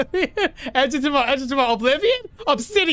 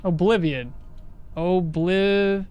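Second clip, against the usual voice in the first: ordinary delivery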